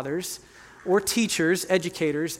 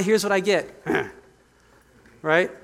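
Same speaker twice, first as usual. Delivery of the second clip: normal talking voice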